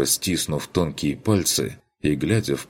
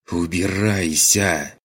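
furious hiss, louder and slow; hissed like a cat; 3 semitones higher, 4 dB louder, slower than usual. A narrator's reading. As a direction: spit it out like an angry cat. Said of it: "кошкой прошипела Маргарет".

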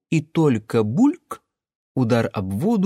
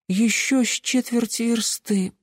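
put-on sleepy child grumbling; 11 semitones higher than usual. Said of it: "буркнула Даринка".

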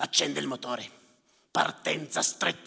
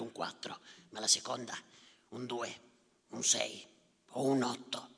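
whisper; 3 semitones lower, 5 dB quieter than usual. Real, not acted.

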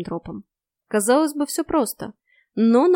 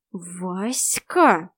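hissed. A narrator's reading. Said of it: "прошипела я".

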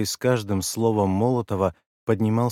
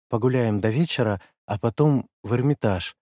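ordinary delivery

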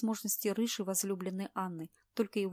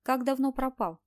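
stern, louder question; 4 semitones higher, 7 dB louder than usual. A narrator's reading. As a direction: ask it sternly. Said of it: "строго спросила".

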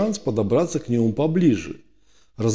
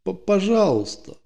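having a heart attack; 8 semitones higher than usual. Acted. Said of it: "простонал в последний раз".

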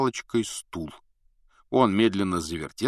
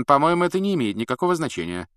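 coldly; 6 semitones higher, 4 dB louder, faster than usual. A narrator's reading. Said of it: "холодно заметила".

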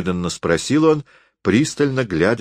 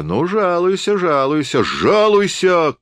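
high, loud, mocking shout, slowing on the last word; shouted taunt; 11 semitones higher, 3 dB louder than usual. A narrator's reading. Drunk forester shouting a taunt at the guest. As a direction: jeer at him to go complain. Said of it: "крикнул лесник".

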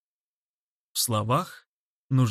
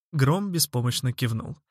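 plain reading voice

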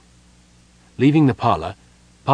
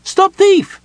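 very high-pitched, loud yell; gnome's bellow; 10 dB louder than usual. Acted. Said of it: "the gnome bellowed".

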